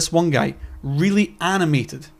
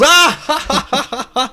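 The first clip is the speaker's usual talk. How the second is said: a much higher, loud gasp into jeering laughter; mock gasp and laugh; 10 semitones higher, 5 dB louder than usual. MPs reacting to a scandal jibe, gasping laughter. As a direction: gasp and cackle like a scandalised crowd. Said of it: "And then everyone goes".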